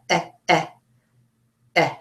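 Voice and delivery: short "eh" repeated; 重复短音"eh". Shown as a demonstration. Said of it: "the one that goes eh".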